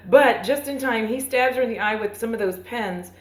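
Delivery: ordinary talk, nothing marked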